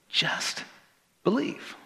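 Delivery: hushed, with a pause between words; soft, high, tender plea; gentle urging. Acted. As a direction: let each word fall softly, separately. Repeats the gentle answer slowly.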